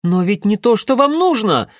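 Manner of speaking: loud, high-pitched desperate pleading; plead, voice climbing high. A narrator's reading. Alyoshka tries to explain to the being, desperate. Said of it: "в отчаянии попытался объяснить Алёшка".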